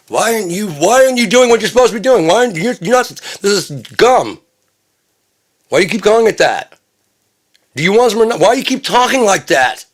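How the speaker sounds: very high, loud acted scolding; high-pitched, fed-up tirade; exasperated yelling. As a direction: yell frantic questions at someone babbling nonsense. Acted exasperated quarrel over gibberish.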